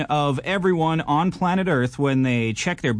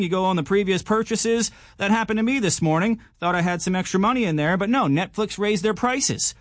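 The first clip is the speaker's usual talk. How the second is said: normal talking voice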